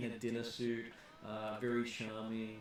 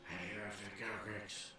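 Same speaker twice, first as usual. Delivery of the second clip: gruff voice; 3 semitones lower, 7 dB quieter than usual. Acted. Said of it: "a really gruff voice says".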